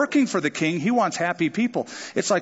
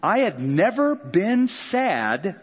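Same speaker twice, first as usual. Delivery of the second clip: slow emphatic reading; 4 semitones higher, slower than usual. Acted. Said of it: "Now watch this".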